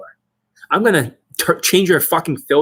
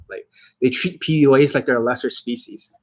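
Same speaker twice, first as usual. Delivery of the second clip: normal talking voice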